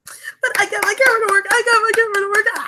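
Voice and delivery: fast, high burst of glee; overjoyed. Real, not acted. Streamer tells viewers the camera works, giddily overjoyed. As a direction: gush it out, ending in a squeal. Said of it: "so happy".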